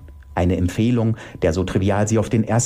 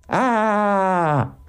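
long, high "aah" scream; high cry; 10 semitones higher than usual. Acted. Anna crying out after being bitten, high cry. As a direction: let out a drawn-out high cry. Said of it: "Da sagt Anna".

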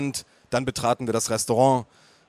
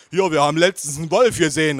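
loud interjection; calling father; 3 semitones higher, 5 dB louder, faster than usual. A narrator's reading. Father joining farm talk, calling out. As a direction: chime in loudly. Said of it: "rief mein Vater".

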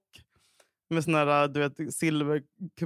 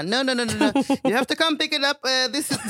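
retold phone protest; 6 semitones higher than usual. Acts insisting on phone to reception.

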